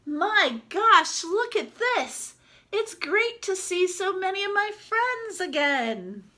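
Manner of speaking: high, amazed exclamation; delighted. Acted. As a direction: gasp with joy at old friends. Duck delighted at many friends.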